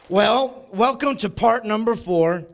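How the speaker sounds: booming church welcome; preacher welcome. Acted. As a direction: open the service with energy. Opens sermon with preacher welcome.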